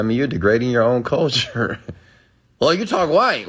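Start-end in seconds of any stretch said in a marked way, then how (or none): 2.6-3.5 higher mocking voice; mock accuser. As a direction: toss out the accusation in a put-on higher tone.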